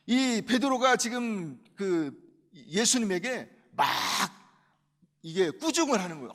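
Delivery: normal talking voice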